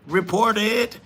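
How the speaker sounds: sarcastic repeat of a chat comment; mocking echo. Real, not acted.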